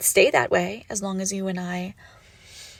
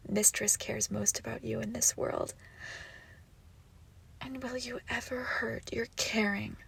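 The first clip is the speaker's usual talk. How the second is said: soft, whispered conditioning; coaxing whisper; 7 dB quieter than usual. Acted. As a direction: whisper it like a lesson to obey. Training captive to obey.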